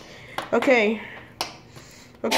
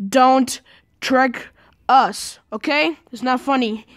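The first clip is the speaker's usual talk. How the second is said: ordinary delivery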